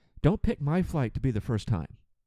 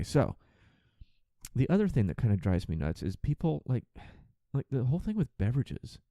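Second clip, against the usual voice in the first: ordinary delivery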